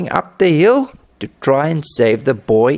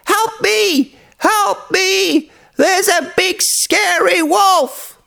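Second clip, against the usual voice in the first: put-on very high, drawn-out boy's cry for help; acted high-pitched alarm, stretched at the end; frightened shout; slower than usual. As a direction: cry out as a scared little boy. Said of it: "shouted as loud as he could".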